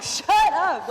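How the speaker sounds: very loud, high re-enacted shout; retold shout. Teacher yelling over classroom noise, imitated shout. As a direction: blurt it out at full volume. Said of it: "shouted out".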